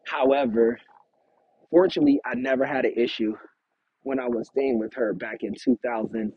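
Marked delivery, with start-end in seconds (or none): none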